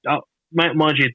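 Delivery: ordinary delivery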